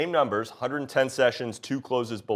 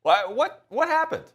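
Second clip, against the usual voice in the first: mock indignation; 11 semitones higher, 4 dB louder than usual. Mock outrage at market.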